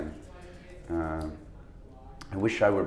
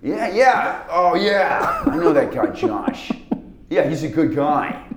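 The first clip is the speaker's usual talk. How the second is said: louder, higher laid-back Rockwell drawl; Sam Rockwell impression; 6 semitones higher, 4 dB louder, slower than usual. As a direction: slide into a loose cool-guy delivery. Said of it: "That's disturbingly good".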